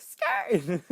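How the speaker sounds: high, loud imitated taunt; mock jeering yell. Imitates bullies jeering loudly.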